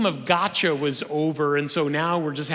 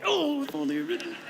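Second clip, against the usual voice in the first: mock panicked regret, higher and softer; whiny self-reproach, hammed up; imitating a nervous believer who skipped the homework; fretting churchgoer; 7 semitones higher, 3 dB quieter than usual. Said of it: "they forgot to read that Lee Strobel book".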